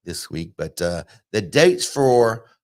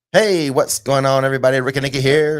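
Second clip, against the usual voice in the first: vlogger voice intro; 3 semitones higher, 7 dB louder than usual. Pretend. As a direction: kick off the show like a big online host. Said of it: "with my vlogger voice intro".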